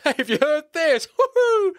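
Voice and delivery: very high, slow mock whoop; Sinbad whooping. Mocking Sinbad's whoop, very high and loud. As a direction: whoop like a manic fool.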